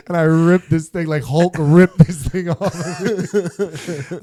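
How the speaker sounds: ordinary delivery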